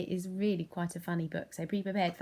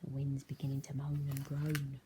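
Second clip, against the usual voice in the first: whisper; 4 semitones lower than usual. A narrator's reading. Teacher to children, eerie whisper. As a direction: hush it like a secret.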